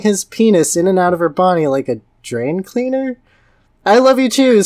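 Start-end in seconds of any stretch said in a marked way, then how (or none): 3.9-4.6 raised, hammed-up love cry; mock scream. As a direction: call it out, half-joking. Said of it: "screamed Amy".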